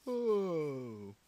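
deep yawned "Oh."; yawning. Real, not acted. Tired player mid-game, a real yawn. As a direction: let a yawn swallow the "Oh.". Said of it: "I'm yawning".

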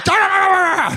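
high shouted mock snarl; imitated dog fight. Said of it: "like two dogs circling each other".

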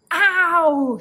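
high, loud yelp of pain; sharp cry of pain; pained scream. Acted. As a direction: yelp as if scratched. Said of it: "screamed the thief".

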